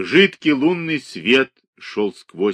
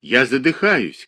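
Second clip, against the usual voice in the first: louder cry, sounding hoarse; suffocating man's cry; hoarse shout; 3 semitones higher, 4 dB louder than usual. A narrator's reading. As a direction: gasp out a shout. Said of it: "крикнул он сиплым голосом".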